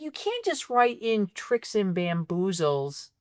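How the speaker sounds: ordinary talk, nothing marked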